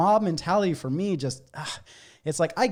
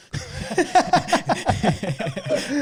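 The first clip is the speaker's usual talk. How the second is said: high, loud burst of real laughter; host cracking up at his own joke; 11 semitones higher, 3 dB louder than usual. Real, not acted. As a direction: crack up loudly.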